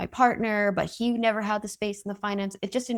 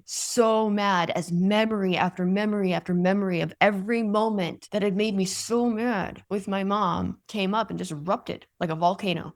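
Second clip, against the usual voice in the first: normal talking voice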